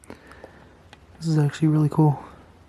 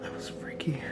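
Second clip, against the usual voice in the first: unvoiced shaken whisper; nervous night vlog whisper; 17 dB quieter than usual. Real, not acted. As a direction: breathe it out, rattled. After being touched.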